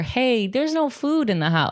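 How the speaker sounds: high-pitched imitation of a younger self; younger self pleading. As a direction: pitch it up as if telling unheeding adults. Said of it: "I was trying to tell people".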